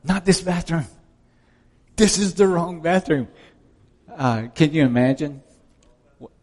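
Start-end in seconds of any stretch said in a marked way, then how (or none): 0.0-3.3 high, strained mock wail; hammed-up maternal horror without shouting; horrified mother. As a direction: imitate a mother in dismay, pitched up. Mother screaming at her daughter.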